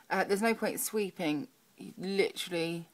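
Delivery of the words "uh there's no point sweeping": usual speaking voice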